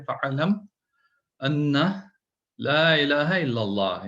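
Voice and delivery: slow, measured chanted Arabic verse; Quranic recitation. Acted. Repeating the verse as reminder.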